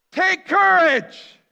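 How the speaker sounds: huge, slow bellowed command over a storm; yelled command. Retold or imitated. Jesus shouts to panicking disciples in the boat, bellowed command. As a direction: roar it across the waves. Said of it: "Jesus is yelling a command at them".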